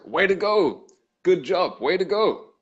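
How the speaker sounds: high, bright, sincere congratulations; genuine praise. Shown as a demonstration. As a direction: cheer them on like you mean it. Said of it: "it depends on the tone of voice".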